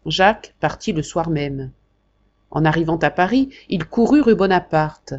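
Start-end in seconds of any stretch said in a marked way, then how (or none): none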